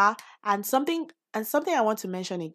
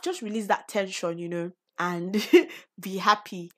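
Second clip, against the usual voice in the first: ordinary delivery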